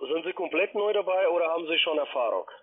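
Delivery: ordinary talk, nothing marked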